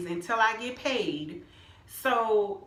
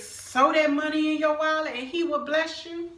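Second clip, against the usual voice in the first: mock church lady; 7 semitones higher, 3 dB louder than usual. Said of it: "She don't know my situation and say".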